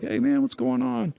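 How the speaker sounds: high, easygoing hey man from the coach; lightly voiced cheerful coach dropping by; friendly coach greeting. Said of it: "he'd come over and be like".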